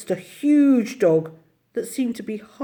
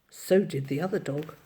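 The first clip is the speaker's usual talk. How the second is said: ordinary delivery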